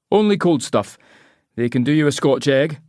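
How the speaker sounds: high, lightly voiced local barman; Scottish barman. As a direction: ask like a curious bartender. Barman returns.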